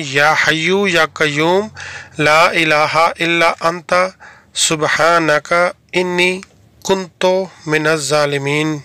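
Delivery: very slow, word by word, with pauses between انی, کنت and من الظالمین; slow word-by-word repeat. Shown as a demonstration. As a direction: spell out یا حیو یا قیوم one word at a time so learners copy it. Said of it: "میں repeat کر رہا ہوں".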